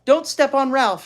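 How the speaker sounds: put-on high, loud little-girl warning; warning child. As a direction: warn the teacher urgently. Ramona warns teacher.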